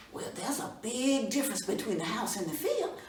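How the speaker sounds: plain reading voice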